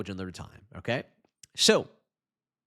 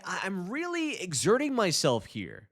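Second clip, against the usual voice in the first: ordinary delivery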